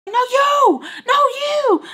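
very high, loud mock yell from mom. Mom yelling at her daughter, very high and loud. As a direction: shriek it back as the furious mom. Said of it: "Shut the f".

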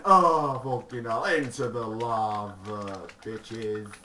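loud gleeful villain; villainous glee. Pretend. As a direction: gloat loudly over the trap. Gleeful villain cackling at creepers.

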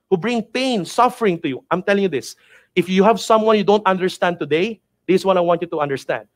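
ordinary talk, nothing marked

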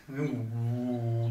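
ordinary delivery

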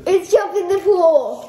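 loud, slow, gleeful announcement; excited. Real, not acted. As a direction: call it out with glee. Reads out a dreaded consequence.

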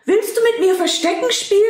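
high, fast and bright with a laugh; cheerful question. Acted. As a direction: bounce up to him, delighted. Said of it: "Fröhlich rannte er zu ihm und fragte".

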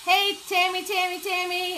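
high-pitched repeated hail; excited greeting. Real, not acted. Host spotting Tammy in the live chat, excited greeting. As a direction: call the name out high and eager. Greets viewer arriving in stream.

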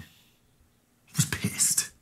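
very slow, breathy whisper; muttered under the breath, barely voiced; whispered admission. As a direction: breathe it out before the outburst. Admitting anger, then repeating it.

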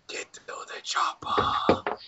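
slow unvoiced whisper. Arnold whispering to the girl, slow whisper. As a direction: breathe it into someone's ear. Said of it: "he whispers into the girl's ear".

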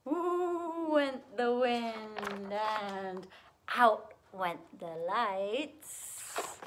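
slow, drawn-out storybook reading; spooky storytelling. A narrator's reading. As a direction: stretch the rhyme out for small listeners. Reads rhyme to children.

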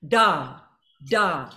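gut-driven accent syllables. Shown as a demonstration. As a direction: bounce it off the wall. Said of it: "Do that anytime you see any of these".

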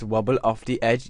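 ordinary delivery

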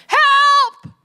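high, loud, drawn-out yell; hammed-up distress scream; panicked cry for help. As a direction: scream for help as if it's an emergency. Woman bursts out in emergency.

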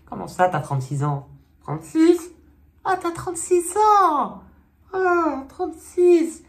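building to high, loud disbelief; mock shock. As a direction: shriek it in hammed-up outrage. Acted shock at Fortuné's age.

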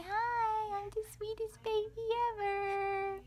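put-on high little baby voice. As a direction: speak for the baby, sweetly.

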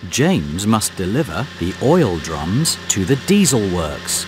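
ordinary talk, nothing marked

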